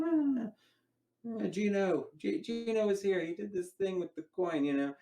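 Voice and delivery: high-pitched, excitable mock Italian; bad Italian accent. Magician playing an Italian talking with his hands, bad Italian accent. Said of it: "That's a really bad Italian accent".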